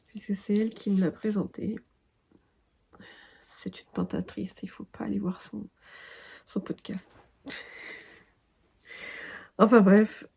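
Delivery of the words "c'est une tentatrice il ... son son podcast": conspiratorial whisper. Real, not acted. Playful confession about friend.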